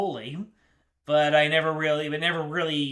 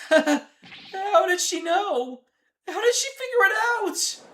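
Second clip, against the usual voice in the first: squeaky high voice, giggling; high character voice; 13 semitones higher, 4 dB louder than usual. Acted. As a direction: pitch way up, amused. Character amazed at being found out.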